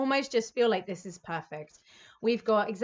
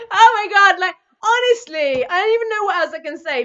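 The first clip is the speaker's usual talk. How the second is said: high, much louder, near tears of joy; overwhelmed cry of delight; 7 semitones higher, 11 dB louder than usual. Real, not acted. Reader overwhelmed by Scorpio's cards, crying out in joy. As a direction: burst out, overcome by the reading.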